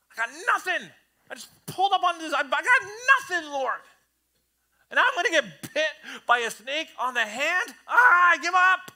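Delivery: high, loud giving-up wail; despairing complaint. Retold or imitated. Worn-out believer complaining to the Lord, high loud wail. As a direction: throw up your hands, high and loud.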